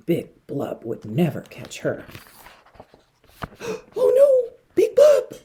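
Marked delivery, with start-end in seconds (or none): 3.4-3.9 sharp high breathless gasp; frightened gasp. A narrator's reading. Tad seeing Big Blub, sharp frightened gasp. 4.0-5.4 very high, loud panicked cry; terrified cry. A narrator's reading. Tad spotting Big Blub, very high panicked cry.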